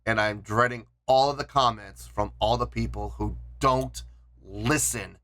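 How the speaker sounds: low, heavy dread with sighs. Real, not acted. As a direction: sigh it out, low and reluctant. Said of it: "I am dreading".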